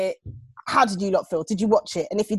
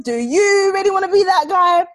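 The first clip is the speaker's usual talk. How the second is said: higher, heavier Jamaican impression; Jamaican accent; 10 semitones higher, 5 dB louder than usual. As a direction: repeat it, more Jamaican. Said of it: "[name] was like".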